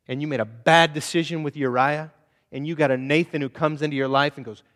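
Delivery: usual speaking voice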